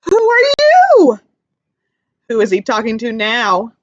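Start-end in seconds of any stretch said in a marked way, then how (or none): 0.0-1.2 put-on much higher, louder and slow baby voice; teacher voicing Joey meeting a bee, high and slow; curious little joey. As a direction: ask it wide-eyed like a tiny kangaroo. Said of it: "He says".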